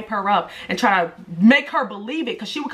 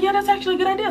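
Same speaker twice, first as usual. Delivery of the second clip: much higher, bright mock agreement; eager agreeing; 12 semitones higher, much faster than usual. Recalling how she agreed to host her friend, in a much higher voice. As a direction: play your past self happily agreeing. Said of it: "And I was like".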